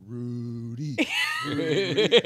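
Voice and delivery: high, loud blubbering wail; mock sobbing. To his brother on the phone, hammed-up sobbing. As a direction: wail the name through fake tears.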